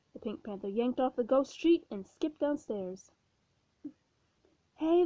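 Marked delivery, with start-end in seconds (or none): 4.7-5.0 higher, louder call after him. Acted. Ghost calling after the Pink Panther, raised call. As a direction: call him back across the house. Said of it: "the ghost called".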